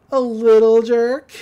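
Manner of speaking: loud, drawn-out playful jab; teasing. Retold or imitated. Sister making up with her brother, loud slow teasing.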